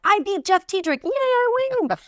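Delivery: high, gleeful mock cheer; high-pitched hammed-up victory cry; mock triumph. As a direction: crow like a race winner, voice climbing very high. Acts out race to reply first.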